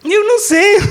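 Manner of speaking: high, rising mock whine; mock helplessness. Preacher jokes as the useless one in trouble, mock-helpless high voice. Jokingly acts his helpless reply.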